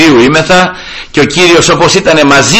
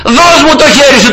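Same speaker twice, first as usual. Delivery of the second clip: higher, urgent call to a drowning man; rescuer calling out; 11 semitones higher than usual. Retold or imitated. Rescuer calling to the drowning cleric, higher and urgent. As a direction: reach out and insist he takes your hand. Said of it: "και έλεγε".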